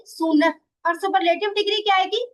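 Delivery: ordinary delivery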